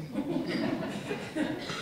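airy high-pitched giggle, no voice; reader's real breathy giggle; breathless high laugh. Real, not acted.

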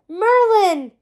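high, loud call of a name; crying out. A narrator's reading. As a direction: call out the name across the hall. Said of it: "he cried".

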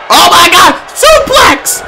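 high, sharp shouted call; excited shout. Real, not acted. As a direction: yell the move out. Reacting to suplex.

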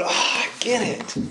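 breathy, drawn-out gasp of getting it; mock awed realization. Preacher showing the congregation how to react, mock awed realization. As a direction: act out an overblown "oh, I get it". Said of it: "come away going".

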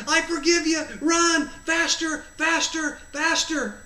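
high, loud urging; cheering on. Retold or imitated. Jesus forgives and spurs the runner, cheering on. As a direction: shout it like a sideline coach.

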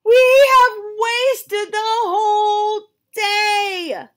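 high, loud, very slow sung wail; wailed lament. Acted. Gerald wails to Piggy they wasted the day, sung wail. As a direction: sing out the despair.

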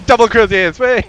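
high, loud gleeful whoop; excited cheer. Real, not acted. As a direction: cheer the win with delight. Cheering a double kill.

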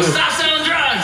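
high-pitched mock yell; hammed-up high shout; calling out. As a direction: holler an accusation out the car window. Calling out from car, acted.

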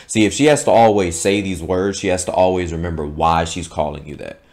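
ordinary talk

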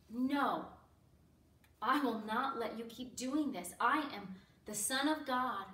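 loud, firm protest; forceful, emphatic declaration; defiant refusal. Acted. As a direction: forbid them with full authority. Imagined defiant refusal by Jesus.

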